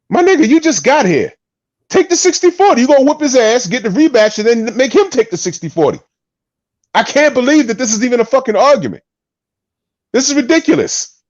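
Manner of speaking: ranting like he can't believe it; exasperated rant. Real, not acted. Host rants to co-host about Bud refusing the split, exasperated.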